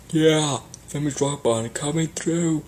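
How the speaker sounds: slow, clearing a path through the crowd; gruff bouncer. A narrator's reading. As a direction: push through like security.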